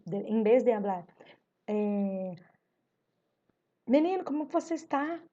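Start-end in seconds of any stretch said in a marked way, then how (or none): 3.9-5.2 "Menino, como você está?" pitched high, rising at the end; pergunta. Shown as a demonstration. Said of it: "A pergunta". ("Menino, como você está?" em tom agudo de pergunta)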